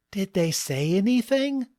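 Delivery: high-pitched, anxious wonder; wide-eyed asking. A narrator's reading. Wife asks husband about the strangers, high wide-eyed voice. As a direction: ask in a high, wondering wife's voice. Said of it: "Tina asked with wide eyes".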